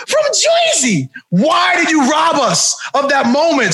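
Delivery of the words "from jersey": high, drawn-out Jersey catchphrase; hammed-up Jersey heel pronunciation; Jersey accent. Crying out the lost payoff, mock Jersey accent. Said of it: "this big jerk from Jersey".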